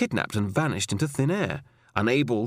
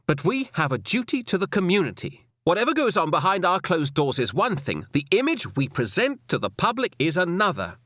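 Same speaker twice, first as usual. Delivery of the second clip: higher woman's voice, measured and firm; firm female superior; 5 semitones higher than usual. Acted. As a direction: lecture him with cool authority. Alison lectures Roy.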